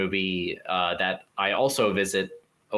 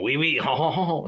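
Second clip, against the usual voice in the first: mock French accent; 6 semitones higher than usual. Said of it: "a guy with a beret going".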